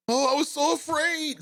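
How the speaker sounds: hammed-up scared whimper; mock whine. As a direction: ridicule the excuse. Mocking the officer's excuse.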